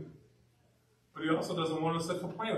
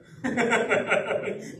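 short real laugh; a quick amused laugh; laughing at his own telling; 5 semitones higher than usual. Real, not acted. Pastor laughs at own story.

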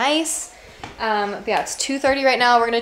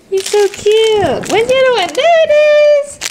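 high pet-talk voice; 14 semitones higher, 14 dB louder than usual. Real, not acted. To her dogs over a toy, high squeaky pet talk. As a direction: coo at the puppy in a squeaky voice.

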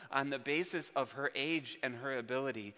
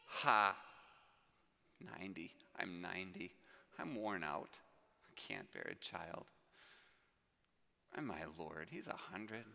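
a hint of a weary old woman, much lower and softer; Sarah's doubt; 8 semitones lower, 3 dB quieter than usual. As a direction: murmur it like a tired, doubting old wife. Voicing old Sarah's doubting thoughts.

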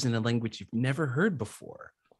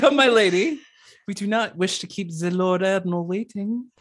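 put-on much higher, louder French-accented maid voice; French maid beckoning her lady, hammed-up; 7 semitones higher, 8 dB louder than usual. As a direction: commit to the wicked maid's French accent. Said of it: "in a sudden French accent says".

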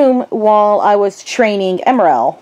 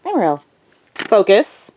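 ordinary talk

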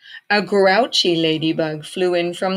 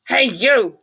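grouchy ladybug voice; 7 semitones higher, 6 dB louder than usual. A narrator's reading. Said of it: "said the grouchy ladybug".

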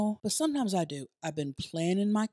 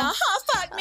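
high, hammed-up cry over the jingle; much higher, playful outburst; jingle catchphrase; 12 semitones higher than usual. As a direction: squeal it in mock ecstasy. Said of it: "when you moan after Chelsea goes".

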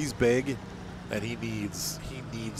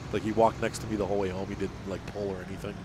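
usual speaking voice

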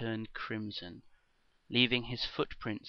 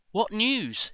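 high, loud desperate plea; anxious pleading; 11 semitones higher, 8 dB louder than usual. Acted. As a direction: press for news, fearing the worst. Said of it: "she pressed again".

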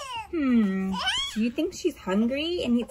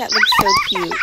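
squealy; 7 dB louder, faster than usual. Pretend. Baby on the swing.

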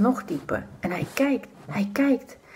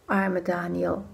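pitying sigh; 2 semitones lower, 4 dB quieter, slower than usual. A narrator's reading. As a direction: breathe it out with sorrow. Pitying aside, slow soft. (zacht medelijdend)

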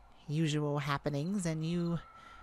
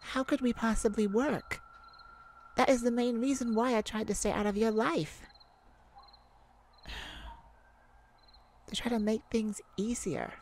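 raised, pained voice, a little higher; louder, hurt and exasperated; upset outburst; 5 semitones higher, 3 dB louder than usual. Acted. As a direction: plead with rising frustration. Despairing lovers' argument.

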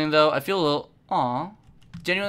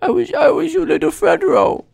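much higher, tearful begging whine; pleading baby voice; 11 semitones higher, 9 dB louder than usual. Acted. Voicing Fredro begging the babysitter for a nappy, pleading baby whine. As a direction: beg like a betrayed little child. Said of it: "he's like".

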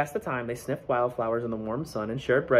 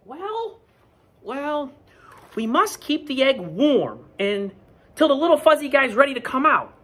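high, slowing then louder; thoughtful Goose; 14 semitones higher, 5 dB louder than usual. Acted. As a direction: muse it out like a careful goose. Goose suggests keeping egg warm.

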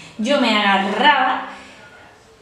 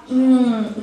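ordinary talk

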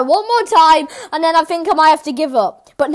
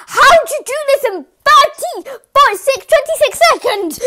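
much higher, exasperated disbelief; real gamer frustration; a bit of rage; 9 semitones higher than usual. Real, not acted. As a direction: demand how it was done, fuming. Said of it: "I went a bit rage".

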